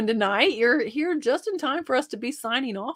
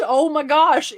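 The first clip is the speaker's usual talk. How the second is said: ordinary talk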